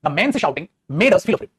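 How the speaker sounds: very slow careful read-out; slow dictation voice. Shown as a demonstration. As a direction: read slowly so every word lands. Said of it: "ध्यान से सुनिएगा".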